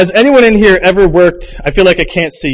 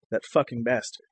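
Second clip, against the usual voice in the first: much softer, lower hushed "That fucking bastard."; mock whisper; 5 semitones lower, 14 dB quieter than usual. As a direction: murmur "That fucking bastard." under her breath like the ex. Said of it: "she whispers".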